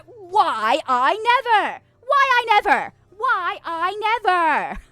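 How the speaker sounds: loud, high, stretched-out affronted cry; mock outrage. Streamer told jokes are dog water, hammed-up outrage. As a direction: wail it like a scandalized melodrama character.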